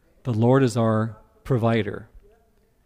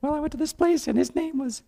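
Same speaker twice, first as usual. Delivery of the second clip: Ruth's voice; 14 semitones higher than usual. Acted. Preacher acting out Ruth answering Naomi, high and innocent. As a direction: play young Ruth, innocent and light. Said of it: "And Ruth says".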